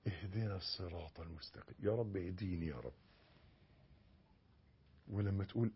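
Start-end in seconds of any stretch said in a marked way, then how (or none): 0.1-2.9 much softer, pleading prayer; supplicating. Shown as a demonstration. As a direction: murmur it as a heartfelt plea. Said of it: "اطلب".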